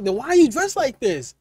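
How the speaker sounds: ordinary talk, nothing marked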